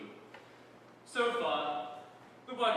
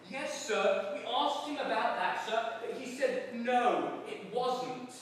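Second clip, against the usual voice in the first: higher, louder jeering prison guard; hammed-up cockney warder; gloating warder; 6 semitones higher, 3 dB louder, slower than usual. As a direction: crow it like a smug jailer. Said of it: "the warder answers".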